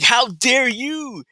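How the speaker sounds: pitched far up and slowed right down; hammed-up mock outrage; mocking Harris. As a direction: stretch out "How dare you?" high and sneering.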